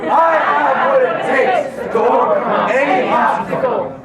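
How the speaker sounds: high, loud rallying shout; shouted affirmation. Shown as a demonstration. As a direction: shout it like a battle cry. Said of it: "Go again".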